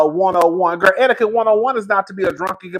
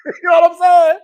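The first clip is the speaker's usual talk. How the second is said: normal talking voice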